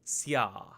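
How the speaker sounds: Tsya said as one clipped syllable; demo syllable. Shown as a demonstration. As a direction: say Tsya as a single beat. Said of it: "one syllable".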